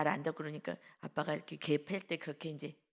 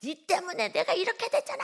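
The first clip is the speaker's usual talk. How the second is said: high, loud outburst of a retold child; imitated furious shriek; screaming in anger; 13 semitones higher, 7 dB louder than usual. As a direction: yell the blame at full pitch, imitating an enraged boy. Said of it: "소리를 막 지르면서".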